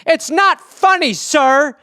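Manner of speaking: high, slow mock indignation; drawn-out, high-voiced reproach; offended crazy stranger. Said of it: "And this guy goes".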